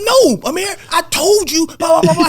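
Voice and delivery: high, loud exasperated refusal; heated refusal. Retold or imitated. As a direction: wave him off loudly. Retold heated argument.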